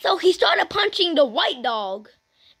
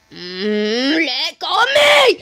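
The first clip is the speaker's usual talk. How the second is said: pretend being choked; 5 dB louder, slower than usual. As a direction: force the words out against a grip. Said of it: "You're choking me".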